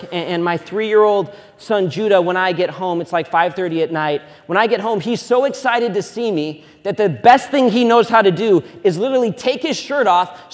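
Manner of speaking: normal talking voice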